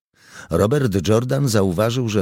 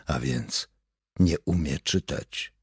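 low, soft aside to oneself; note it privately, under the voice; hushed silent realisation; inner thought; 4 semitones lower, 6 dB quieter than usual. A narrator's reading. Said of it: "zauważył w duchu Robert Jordan".

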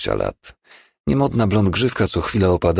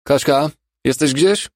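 high, raised call across the ward; 10 semitones higher, 4 dB louder than usual. A narrator's reading. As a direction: call out for a colleague down the room. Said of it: "krzyknął w głąb sali". (wołanie podniesionym głosem w głąb sali)

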